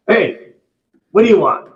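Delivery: loud, high, crass shout; rude Massachusetts greeting. Retold or imitated. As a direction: bark it like a rude Masshole. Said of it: "crass and rude".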